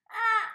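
high, loud fangirl squeal; excited fan squeal. Real, not acted.

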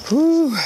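loud breathy whew after running; relieved exhale. Real, not acted. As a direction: blow out hard after effort. Finished a mile, blowing out.

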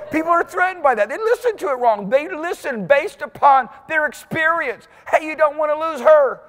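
high, loud sermon delivery; full-force preaching. Acted. Preacher driving the point home to the church, fervent. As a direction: preach it hard to the pews.

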